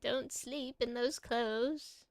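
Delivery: put-on slow old woman voice; a hint of granny, slowly; old lady voice. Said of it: "my old lady voice".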